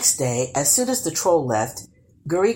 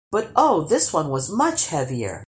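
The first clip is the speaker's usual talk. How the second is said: ordinary delivery, nothing marked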